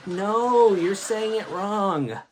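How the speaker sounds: loud, high, drawn-out "no"s; high-pitched, stretched-out shouted objection; whole class protesting. Retold or imitated. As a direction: yell like a roomful of kids correcting the teacher. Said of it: "The whole class be like".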